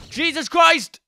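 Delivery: very high, loud shocked cry; jolted by an explosion; startled scream. Real, not acted. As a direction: shriek in sudden shock. Ghast blast startles.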